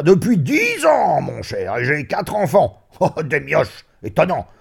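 high, loud, proud cry with a laugh; boisterous boasting at full voice; jovial shout. Acted. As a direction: bellow the family news happily. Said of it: "Il cria".

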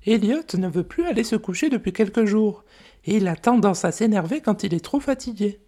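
high, gentle mother dragon voice; a fond, worried dragon mum. Acted. Mother dragon telling the visitors about her son, high gentle voice.

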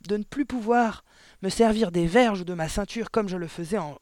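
blustering headmistress fury; thundering threat. A narrator's reading. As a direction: threaten with relish and outrage. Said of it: "tonna mademoiselle Le Gourdin".

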